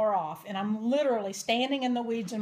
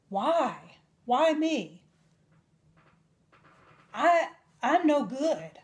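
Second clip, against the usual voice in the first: higher, slow, breaking plea; anguished plea; 4 semitones higher, slower than usual. Retold or imitated. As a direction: ask why through tears. Broken prayer to God.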